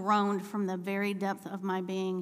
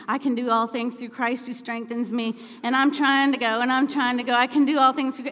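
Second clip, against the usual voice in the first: loud, strained prayer, building higher; acted-out uphill praying, louder as it goes; praying through strain; 4 semitones higher, 9 dB louder than usual. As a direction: push out a desperate prayer while pedaling. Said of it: "I'm praying".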